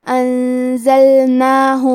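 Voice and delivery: انزلناہ chanted very slowly, the نون hidden in a held nasal hum before ز; nasal ghunnah demo. Shown as a demonstration. Teacher showing ikhfa to students, slow held nasal انزلناہ. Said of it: "نون ساکن کو چھپاتے ہوئے اور غنہ کے ساتھ".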